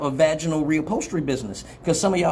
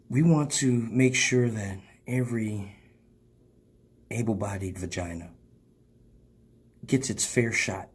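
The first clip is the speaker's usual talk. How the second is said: commercial voice; 4 semitones lower, 6 dB quieter than usual. Acted. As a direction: sell it like a late-night ad. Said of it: "Why am I still talking like a commercial".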